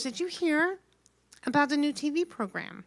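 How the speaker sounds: a nosy neighbour making small talk; chatty lady voice. Retold or imitated. Voicing chatty lady in waiting room.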